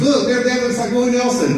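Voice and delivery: imitating mom. Mom in the front row teasing the crowd, high mock voice. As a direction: pipe up like his teasing mom. Mom's quip voiced in the story.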